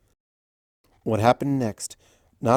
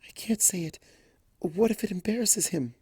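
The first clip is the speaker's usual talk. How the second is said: softer, higher fretting; hushed worry for a friend; worried; 8 semitones higher, 5 dB quieter than usual. A narrator's reading. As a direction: murmur it anxiously. Hesitant worried aside.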